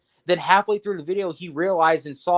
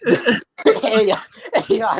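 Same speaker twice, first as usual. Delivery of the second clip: laughing; 5 semitones higher, 4 dB louder than usual. Real, not acted. Laughing mid-sentence.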